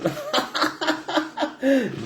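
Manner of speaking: high-pitched burst of laughter. Real, not acted. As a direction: laugh at own slip of tongue. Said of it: "quel lapsus quand même".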